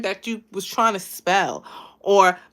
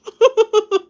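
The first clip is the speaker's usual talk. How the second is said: Stitch giggle; 13 semitones higher, 6 dB louder than usual. Shown as a demonstration. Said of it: "It sounds like Stitch".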